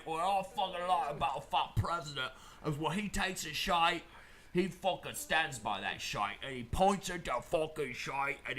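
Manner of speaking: rambling mock-Cockney toast; hammed-up Cockney pub talk; Cockney accent. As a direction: do a broad, bad Cockney. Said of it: "I'll do it in Cockney accent".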